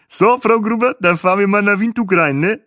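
high, loud African tour guide voice; chirpy guide with a foreign lilt; Bahe's voice. A narrator's reading. Tour guide greets his passenger, high and chirpy.

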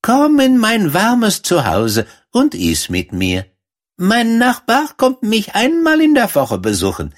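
high-pitched mouse voice, hospitable; kindly field mouse. A narrator's reading. Field mouse invites the girl in and recommends her neighbour, high mouse voice.

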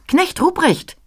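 viel höher, helle Kinderstimme des Christkinds; helle Christkind-Stimme. A narrator's reading. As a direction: ruf hell und hoch wie ein Kind. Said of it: "da rief's mich mit heller Stimme an". (hoch und hell rufendes Christkind)

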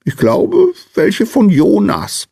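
very high piping voice, thoughtful; squeaky little man. A narrator's reading. Little Sandman guessing which dreams he brought, piping. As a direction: squeak it out, musing. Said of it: "piepste der kleine Mann".

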